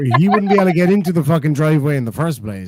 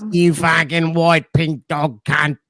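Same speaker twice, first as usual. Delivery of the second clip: mock Australian Bogan, slow, drawn-out insults; Ozzy Bogan accent; slower than usual. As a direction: ham up a crude Aussie Bogan cursing. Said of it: "Ozzy Coded is fucking hysterical".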